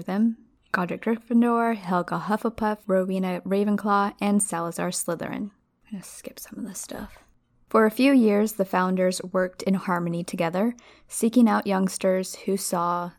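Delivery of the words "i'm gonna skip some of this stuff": quick whispered aside. Real, not acted. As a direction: whisper it off to the side. Aside while reading.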